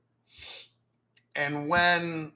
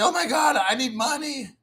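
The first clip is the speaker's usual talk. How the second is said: mock panic; 7 semitones higher than usual. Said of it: "and say".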